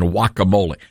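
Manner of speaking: guacamole said with the G dropped; silent G. Shown as a demonstration. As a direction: start guacamole on a W sound. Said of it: "it is silent on".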